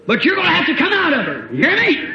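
high, loud commanding rebuke; rebuking the devil. Retold or imitated. Preacher rebuking the devil in the girl, high loud command. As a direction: confront the devil head on.

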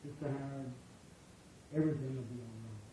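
very soft, quick comfort; soft, quick reassurance to a sick sister. Shown as a demonstration. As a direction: murmur it kindly and move on. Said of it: "And I say".

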